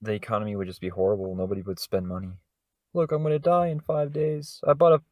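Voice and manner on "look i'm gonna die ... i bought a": mock doomed shopper. Voicing a doomed spender.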